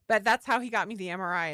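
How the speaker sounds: ordinary talk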